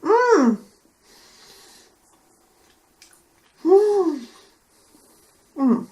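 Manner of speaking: chewing while humming; crunching caramel. Real, not acted. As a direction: mouth full, mmh while eating. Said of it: "Désolée pour le bruit".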